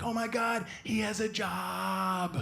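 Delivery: high, slow laughing gush; high, slow mock girlfriend gushing over a dog in a vest; imitating girlfriend gushing. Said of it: "She'd be like".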